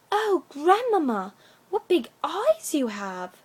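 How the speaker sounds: put-on drawn-out childish wonder; wondering little girl. A narrator's reading.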